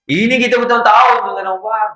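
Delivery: preaching shout. Real, not acted. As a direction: raise the voice to rouse the hall. Preacher rebukes years of grudges.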